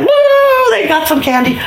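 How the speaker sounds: very high, gleeful whoop; excited cheer. Acted. Storyteller as boys run for candy, high gleeful whoop. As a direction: whoop like kids racing for treats.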